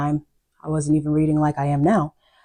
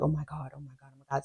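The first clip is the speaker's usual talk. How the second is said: hushed awe; 12 dB quieter, much faster than usual. Retold or imitated. Retelling reading with best friend.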